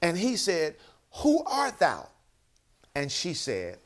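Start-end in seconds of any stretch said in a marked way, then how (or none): 1.1-2.1 high, loud startled demand; frantic. Acted. Boaz questioning the woman at his feet, frantic. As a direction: challenge an intruder with the voice jumping up. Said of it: "Can't you see him probably being frantic".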